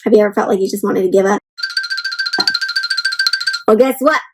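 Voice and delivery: usual speaking voice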